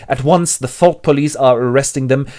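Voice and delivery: ordinary talk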